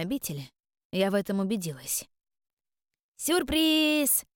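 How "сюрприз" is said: high excited yell; joyful shout. Acted. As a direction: burst in with a happy surprise. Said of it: "прокричала радостно нечисть".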